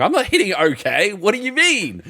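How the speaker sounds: high-pitched incredulous protest, played for laughs; mock outrage. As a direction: rise into an exaggerated, indignant question. Retelling outrage at an in-game purchase.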